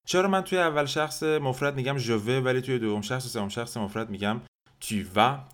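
normal talking voice

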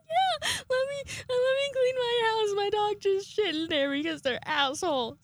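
very high, near-crying squeal easing down; mock overwhelmed. Fan inviting the band in, high overwhelmed squeal. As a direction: squeal it, hammed-up and tearful. Imagined tearful starstruck invitation reply.

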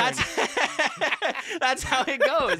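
high laughing voice. Real, not acted. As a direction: laugh through the words. Laughing at the callback.

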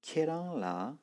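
Keran pitched high on both syllables; two high tones. Shown as a demonstration. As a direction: start Keran high and keep it up. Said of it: "on commence bien les deux tons hauts".

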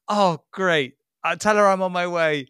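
big-smile voice, high and loud; overdone mock cheer; mock promo actor. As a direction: ham up delight like a bad advert actor. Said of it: "gives this big smile".

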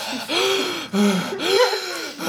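high mock out-of-breath gasp; hammy gasp for air after a hill; imitated heavy gasp. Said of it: "she was like".

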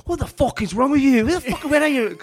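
high angry shouting at the kitchen; retold chef's yell. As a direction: bark it like a furious head chef. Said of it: "and Martin's like".

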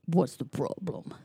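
low mock deep guard voice. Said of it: "the deep voice".

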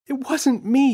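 yelled. Acted. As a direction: kid protesting his innocence. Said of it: "yelled James".

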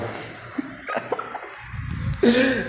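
airy giggle, pitched high; high breathy laugh. Real, not acted. Laughs recalling the fall.

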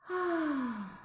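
high sigh sliding steadily down; deep sigh. Shown as a demonstration. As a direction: breathe out a long "ah" of release. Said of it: "exhale again with a deep sigh".

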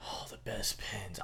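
slow soft whispered answer. Acted. Answering his friend's whispered question in a whisper. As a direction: whisper it back, drawn out.

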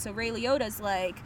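normal talking voice

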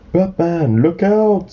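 urgent warning. Acted. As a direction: call out to warn your friend. Warning a friend in story.

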